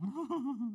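a quick amused laugh; short laugh. Real, not acted. Pastor laughing at his own aside, quick laugh. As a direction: laugh briefly at the idea.

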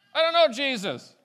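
loud, high emphatic shout; exclaimed denial. Acted. Preacher voices Peter denying he knows Jesus, shouting. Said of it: "it's even an exclamation point".

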